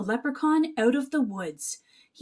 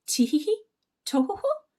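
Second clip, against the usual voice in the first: tee-hee giggle; 8 semitones higher, faster than usual. Acted. Leprechaun giggle again.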